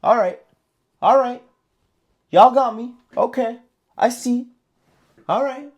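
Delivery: a hint of a savvy player nodding along; wised-up trader. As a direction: act the trader who caught on. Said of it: "look at the game and go".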